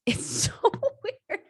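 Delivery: high, slow, laughing "so weird"; giggling amazement; laughing disbelief. Real, not acted. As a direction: let laughter break the words.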